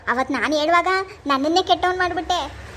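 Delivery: normal talking voice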